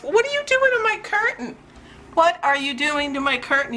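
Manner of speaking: high-pitched, exasperated pet scolding. Real, not acted. Owner catching Salem at the curtain, high-pitched scolding. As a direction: scold the naughty cat in a high voice.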